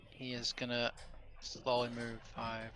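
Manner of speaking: ordinary delivery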